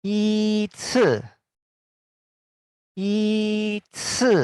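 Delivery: "Yi ci" with yi high and level; "Yi ci" with first-tone yi. Shown as a demonstration. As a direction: hold "Yi" flat high. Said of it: "ini juga".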